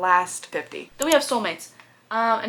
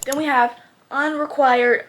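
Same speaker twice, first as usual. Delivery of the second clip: ordinary talk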